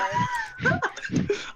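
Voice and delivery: big high burst of laughter. Real, not acted.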